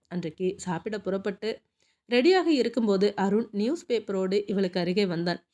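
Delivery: ordinary delivery, nothing marked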